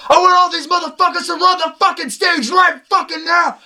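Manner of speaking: very high, full-out hype shout; high-pitched imitation of a stage yell. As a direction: scream it like a rapper riling up the crowd. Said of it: "I hear [name] goes".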